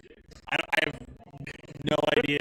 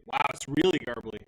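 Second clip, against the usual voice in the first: ordinary talk, nothing marked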